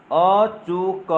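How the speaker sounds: very slow, each syllable held long; slow syllable dictation. Shown as a demonstration. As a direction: dictate the memory word sound by sound. Spells out next mnemonic syllables.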